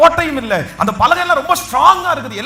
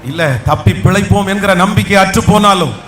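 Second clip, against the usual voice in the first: loud, lower preaching voice that builds; full-force preaching; 6 semitones lower, 6 dB louder than usual. Acted. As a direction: preach it with fire to the whole room. Preacher exhorting the congregation. (முழு வீச்சில் உரத்த பிரசங்கம்)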